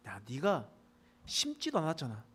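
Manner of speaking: ordinary talk, nothing marked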